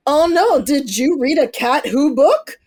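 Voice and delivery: sarcastic gasp at a mystery reader; mock shock. Mocking the critics in high mock outrage.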